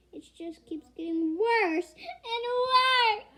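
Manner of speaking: loud, high, slow sobbing wail; crying out. A narrator's reading. Mother despairing over her daughter, crying out. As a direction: wail it through tears. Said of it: "She began to sob".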